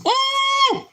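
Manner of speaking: sudden high whoop of delight; high excited woo. Real, not acted. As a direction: whoop with joy. Excited about hot dogs.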